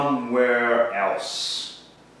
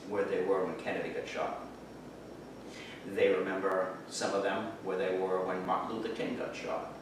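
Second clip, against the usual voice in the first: hushed solemn; 9 semitones lower, 7 dB quieter than usual. Acted. Solemn recollection of tragedies.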